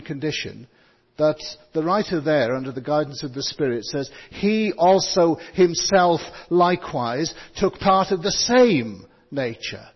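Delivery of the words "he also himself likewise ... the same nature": HE ALSO HIMSELF LIKEWISE, louder and slow; weight each word of "He also himself likewise"; same emphasis. Shown as a demonstration. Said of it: "same emphasis".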